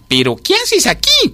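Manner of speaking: very high, slow demand; affronted storybook ant; indignant surprise. A narrator's reading. Startled ant confronts cicada.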